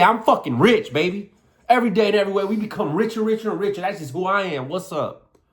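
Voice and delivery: punchy, excited self-hype; hyped-up conviction. Shown as a demonstration. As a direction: swagger through the affirmation. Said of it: "You see how I just said that? Automatically, the emotion came out".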